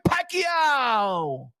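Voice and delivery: building, high, shouted ring-announcer hype; ring announcer intro. Acted. Hyping up fans for Pacquiao's return, announcer shout.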